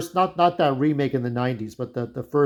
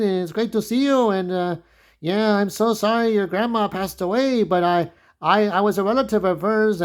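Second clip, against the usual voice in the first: higher, chummy greeting voice; lightly voiced cousin, higher and friendly; cousin Danny greeting; 5 semitones higher than usual. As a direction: play the eager long-lost cousin, pitched up.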